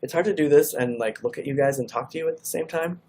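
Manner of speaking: normal talking voice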